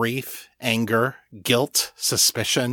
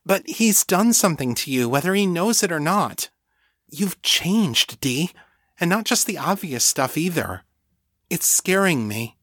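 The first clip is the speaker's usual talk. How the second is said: lightly voiced, higher-pitched worried friend; worried friend Rebecca; 7 semitones higher than usual. A narrator's reading. Rebecca warning Danny that she has changed, worried. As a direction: plead a little higher, with concern.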